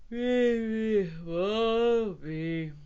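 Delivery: drawn-out sleepy bear sigh. A narrator's reading. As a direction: sigh it out as your eyes close. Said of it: "sighed the bear".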